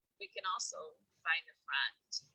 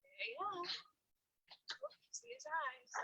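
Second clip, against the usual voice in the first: much higher and softer, cooing; 12 dB quieter than usual. Acted. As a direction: coo over the little butterfly. Second reader voices figure.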